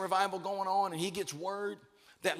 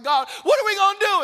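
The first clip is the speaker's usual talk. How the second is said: high, loud, fast desperate shout; panicked cry; 10 semitones higher, 10 dB louder, faster than usual. Retold or imitated. Moses trapped at the Red Sea, crying out to God in panic.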